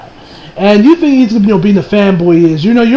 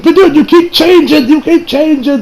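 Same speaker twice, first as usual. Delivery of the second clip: higher-pitched mock protest; exasperated complaint; 8 semitones higher than usual. Kaminari protests to Bakugo about the changing tempo, exasperated. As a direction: complain like a student who can't keep up.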